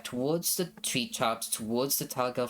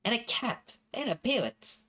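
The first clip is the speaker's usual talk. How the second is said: put-on high, childlike girl voice; little girl voice; 9 semitones higher than usual. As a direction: voice a small girl. Voices little sister in game.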